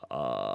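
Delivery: smooth yawn glide with the larynx down; yawning sigh. Shown as a demonstration. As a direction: yawn through it without breaks. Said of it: "Let's try one more time".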